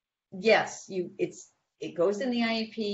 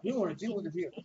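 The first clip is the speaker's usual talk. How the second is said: a lightly voiced stiff principal, a bit softer; mock principal; 3 dB quieter, faster than usual. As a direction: mimic a principal brushing off a parent. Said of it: "that's how all principals talk".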